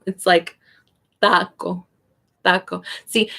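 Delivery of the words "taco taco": "taco" with a soft, unaspirated T; tongue behind the teeth for "taco"; soft Spanish T. Shown as a demonstration. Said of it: "it's softer than in English".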